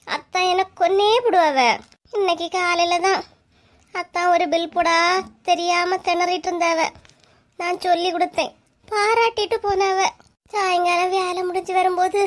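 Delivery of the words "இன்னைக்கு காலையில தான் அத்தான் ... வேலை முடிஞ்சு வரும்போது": ordinary talk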